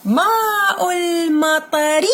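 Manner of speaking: a teacher's slow, careful model read. Shown as a demonstration. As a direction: stretch it out slowly for the pupil. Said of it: "teacher models slow reading".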